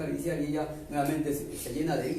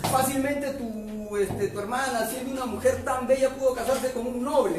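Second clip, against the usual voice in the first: higher, tearful indignation; indignant complaint; 7 semitones higher than usual. Acted. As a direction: plead with wounded pride.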